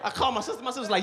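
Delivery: usual speaking voice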